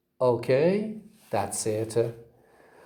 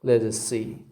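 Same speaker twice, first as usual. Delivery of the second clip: ordinary talk, nothing marked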